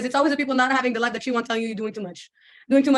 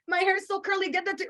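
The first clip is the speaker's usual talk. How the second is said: mock bragging girl; 8 semitones higher than usual. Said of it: "I was like this".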